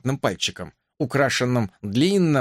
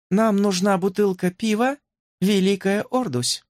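higher, clear ringing voice; crystal voice; 8 semitones higher than usual. A narrator's reading. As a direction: ring it out like crystal. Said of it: "хрустальным голосом проговорила принцесса".

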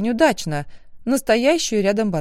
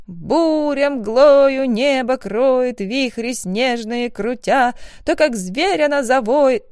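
slow, higher, declaimed poetry; poem recital; 5 semitones higher, 4 dB louder, slower than usual. Acted. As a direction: recite it grandly, slowly, as a test.

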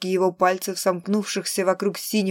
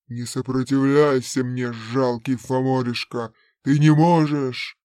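low, rising menace; menacing villain voice; 6 semitones lower, 4 dB louder than usual. Acted. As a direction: crush his will with contempt. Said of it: "villain overpowering Thane's will". (низкий угрожающий голос злодея)

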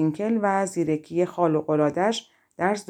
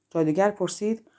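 ordinary delivery

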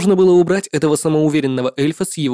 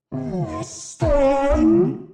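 higher demonic summons, rising; rising demon cry; 12 semitones higher, 4 dB quieter than usual. Acted. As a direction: call the dead up, pitch climbing. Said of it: "гортанно прокричал демон".

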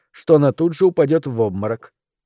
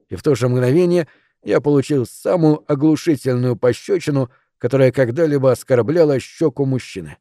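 usual speaking voice

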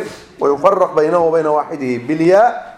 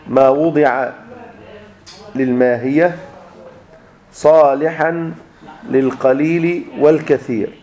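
slow, word by word with long pauses; measured dictation, drawing out the words; dictating; slower than usual. Real, not acted. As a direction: dictate it slowly, phrase by phrase. Teacher dictating a definition.

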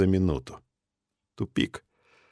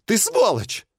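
high furious yell of an insult; angry shout; 13 semitones higher, slower than usual. Acted. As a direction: scream it in rage, voice jumping high. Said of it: "крикнул биолог".

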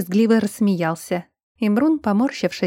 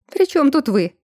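genuinely puzzled, pitched up; sincerely surprised; 6 semitones higher, 3 dB louder than usual. A narrator's reading. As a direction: lift the question in mild surprise. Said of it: "искренне удивилась Эльза".

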